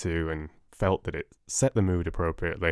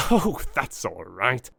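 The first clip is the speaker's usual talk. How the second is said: merry laugh; 4 semitones higher than usual. A narrator's reading. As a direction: laugh merrily and settle it. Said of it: "he cried with a merry laugh".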